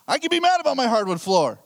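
high, loud, fast protest; indignant retold outburst, rushed and high; mad. Dad defending himself to his daughter, mad. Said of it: "I got mad at Mara".

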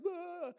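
a high, loud mock wail; retold sob cry, hammed up; imitated sob. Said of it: "The lady was just sobbing".

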